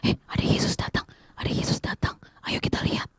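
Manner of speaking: hushed whisper passed through a crowd; bisikan. Acted. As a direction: whisper the news to a neighbour. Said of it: "Bartimeus cuma dengar bisikan". (berbisik pelan memberi kabar)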